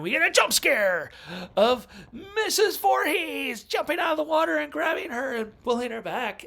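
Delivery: loud, high mock-horror holler; jump-scare shout. Film fan retelling Mrs. Voorhees grabbing her, high jump-scare shout. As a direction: blast it out high like a sudden movie scare.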